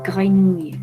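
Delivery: ordinary delivery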